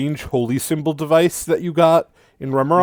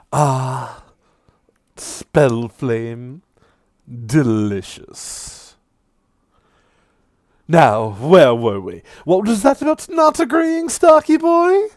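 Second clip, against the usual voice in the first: mocking devil; 4 dB louder, slower than usual. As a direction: sneer at him with relish. Sirik taunts.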